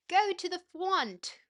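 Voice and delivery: put-on high, loud, drawn-out child's order; exclaiming. Angry boy throwing his toy down, exclaiming. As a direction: cry it out like a cross little boy.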